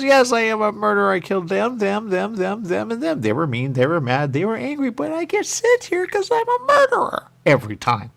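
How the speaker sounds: high, loud mock murderer confession; squeaky cartoon killer bragging; hammed-up villain. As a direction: play the proud killer, over the top. Voicing the murderer character.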